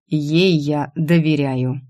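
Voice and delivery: slow, measured words with weight on «Ей»; stress on ЕЙ. A narrator's reading. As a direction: set «Ей» apart deliberately, each word distinct. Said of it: "отчеканил он, делая акцент на первом слове".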